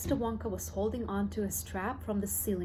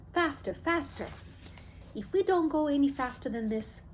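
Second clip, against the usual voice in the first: higher, eager urging; excited urging; 6 semitones higher than usual. Acted. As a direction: spur the lift to go faster. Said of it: "cried Mister Wonka".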